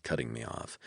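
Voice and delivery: usual speaking voice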